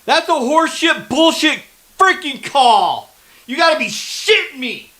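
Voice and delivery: loud, high, furious shouting at the refs; furious yelling. Real, not acted. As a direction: yell it out, outraged at a bad call. Fan furious at referee's flag.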